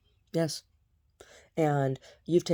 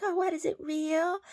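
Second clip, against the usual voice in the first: very high, put-on silly voice; mocking imitation. Imitating the woman asking if the rat is real, mocking. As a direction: mimic her squeaky fake surprise. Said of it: "She's like".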